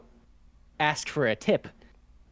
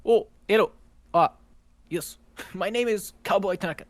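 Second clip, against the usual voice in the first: put-on Japanese accent; 5 semitones higher than usual.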